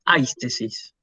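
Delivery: aistesis with the stress on A in AIS; first-vowel stress. Shown as a demonstration. Teacher explains the diphthong rule, stressing first vowel. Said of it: "se pronuncia en el primero".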